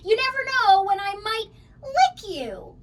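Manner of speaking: high, loud hammed-up monster menace; trying to sound terrifying. A narrator's reading. Monster Mac tries to scare the kid, hammed-up and loud. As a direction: ham up a scary monster threat. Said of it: "trying to sound terrifying".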